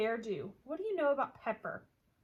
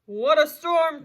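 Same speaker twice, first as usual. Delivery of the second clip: twittering wren; 5 semitones higher, 14 dB louder, slower than usual. Acted. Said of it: "twitters Quen-, wren".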